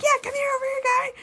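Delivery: high-pitched beckoning call; imitated calling over. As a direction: imitate classmates waving someone over. Retelling classmates calling someone over.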